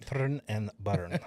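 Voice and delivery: a slow, laughing bad Russian accent; mock Russian movie accent. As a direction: drawl "Turn and burn" in a jokey Russian accent. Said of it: "do everything that John Malkovich did".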